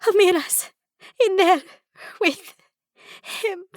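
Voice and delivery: high, broken sobbing words. Acted. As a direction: sob it out in pieces. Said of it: "Jabira sobs".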